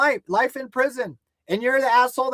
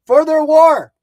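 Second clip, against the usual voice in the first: angry rant; 5 semitones higher, 7 dB louder, slower than usual. Real, not acted. Ranting at enemies on stream.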